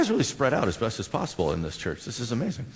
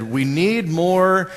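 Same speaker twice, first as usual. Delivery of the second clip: slow, raised yell of command; Captain Kirk; 5 semitones higher, 5 dB louder, much slower than usual. Retold or imitated. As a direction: stretch the words out, louder and higher. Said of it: "He would yell out to Scotty".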